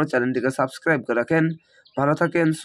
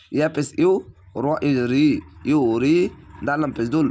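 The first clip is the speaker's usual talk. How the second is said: ordinary delivery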